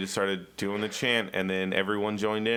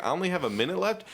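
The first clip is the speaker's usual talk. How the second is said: surprised; 7 semitones higher, faster than usual. Retold or imitated. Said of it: "I was like".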